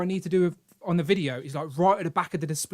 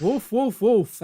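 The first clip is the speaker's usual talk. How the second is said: higher-pitched echo of a read-out voice; higher, lightly parroted words; imitating automated read-out; 5 semitones higher, much slower than usual. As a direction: lightly mimic a voice reading out emoji. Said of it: "Friday actually read out".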